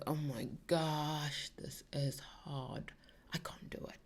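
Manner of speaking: slow, soft whining whisper; whiny defeated. Retold or imitated. Son facing hard homework, a slow whiny whisper. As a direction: fade into a whiny hopeless whisper. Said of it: "he goes".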